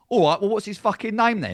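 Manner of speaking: mock indignant fan asking the name; mocking British bloke. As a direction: sneer like an outraged Brit. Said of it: "only British people go".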